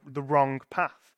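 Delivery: usual speaking voice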